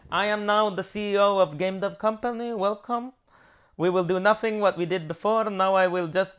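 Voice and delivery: hammed-up boss proclaiming he runs the company; doing Arash. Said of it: "It is me actually doing Arash".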